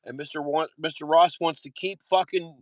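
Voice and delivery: usual speaking voice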